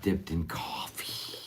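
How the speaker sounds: slow unvoiced whisper. Real, not acted. Whispering a snack tip to viewers. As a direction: whisper it slowly like a secret.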